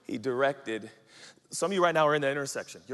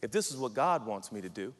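lower, measured and deferring; humbly submitted; 6 semitones lower, 4 dB quieter than usual. Acted. Planner submits plans to God, humble and measured. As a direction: offer it up humbly. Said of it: "you ought to talk like this".

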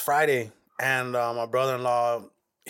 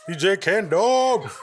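drawn-out, louder DJ call; hype announcer; 5 semitones higher, 5 dB louder, slower than usual. Acted. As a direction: hype up the name like an MC. Echoes the name as a hype call.

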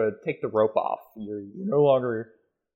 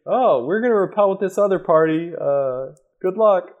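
calling up; 7 semitones higher than usual. Acted. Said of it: "And you say".

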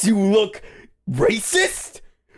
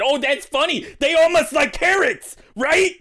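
mocking quote; 7 semitones higher than usual. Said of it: "And you said".

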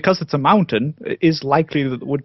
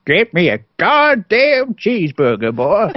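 put-on slow, higher old American man's drawl; 3 semitones higher, slower than usual. Guest shows off his mock American voice on a dare, old man American. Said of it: "the old man American voice".

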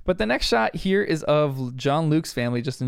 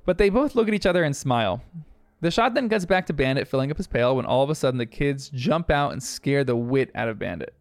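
ordinary talk

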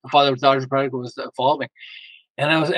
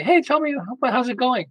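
inviting check-in; 8 semitones higher than usual. Retold or imitated. Calling daughter, inviting her to talk.

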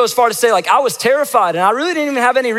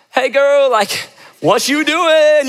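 higher, drawn-out smooth greeting; slow, casual, trying to sound smooth; mock-smooth hello to the girl on the phone; playing it cool; 7 semitones higher, much slower than usual. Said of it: "And I'm like".

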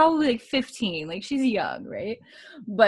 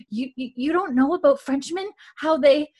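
imitating a nervous stutter, pitched up; stuttering Mary; 6 semitones higher than usual. Podcaster retelling Mary's nervous line to her cohost, stuttering. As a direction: stumble over the repeated words, a bit higher. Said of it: "Mary has a little bit of a stutter".

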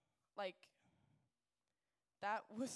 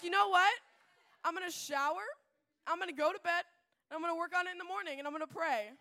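excited resolve; 7 semitones higher, 10 dB louder, faster than usual. Retold or imitated. Sudden resolve after breathing, eating Takis.

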